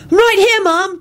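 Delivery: high yelled reply; yelled back. A narrator's reading. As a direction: shout back across the house. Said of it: "Tim yelled back".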